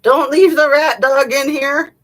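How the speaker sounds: loud high call across the house. Real, not acted. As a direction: shout it after them as they go. Calling out to someone leaving.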